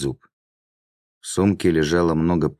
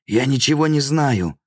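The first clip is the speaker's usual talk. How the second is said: much higher, strained denial; choked wheeze; 9 semitones higher than usual. A narrator's reading. Throttled bandit to demon, choked and high. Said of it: "прохрипел бандит".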